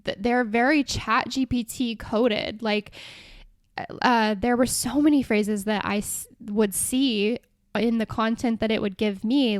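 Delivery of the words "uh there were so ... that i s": ordinary talk, nothing marked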